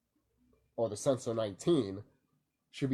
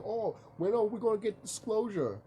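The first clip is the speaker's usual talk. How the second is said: lightly mocking, higher-pitched question; imitating impatient people; 9 semitones higher than usual. People asking her about disclosure, lightly mimicked in higher pitch.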